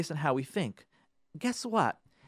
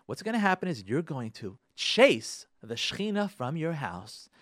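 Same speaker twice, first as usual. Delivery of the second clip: usual speaking voice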